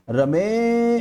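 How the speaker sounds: रमे with the ए drawn out very long and slow; रमे called with a long drawn ए; stretched vowel. Shown as a demonstration. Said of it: "इसे पकड़कर मैं खींच रहा हूँ".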